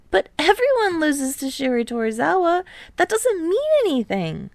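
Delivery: a touch of worry, pitched high; anxious reassurance; fretting. Acted. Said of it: "Tadashi's fretting now".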